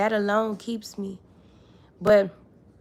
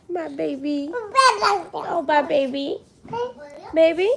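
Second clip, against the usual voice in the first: higher-pitched cooing; 8 semitones higher, 5 dB louder than usual. Real, not acted. Mother greets her baby mid-livestream, higher cooing baby talk.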